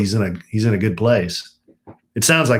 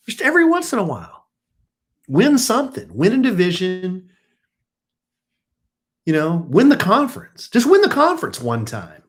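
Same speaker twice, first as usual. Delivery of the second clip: whiny fan; 9 semitones higher than usual. Real, not acted. Fan rants about the Cowboys.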